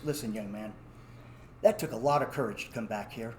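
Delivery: lightly voiced older authority; fatherly manager. Manager kindly addresses the boy.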